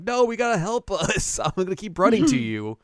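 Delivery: put-on high, loud childish plea; high-pitched mock kid begging for help. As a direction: squeak it out like a pleading kid. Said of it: "they're like".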